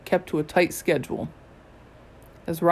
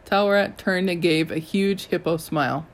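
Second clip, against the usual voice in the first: ordinary delivery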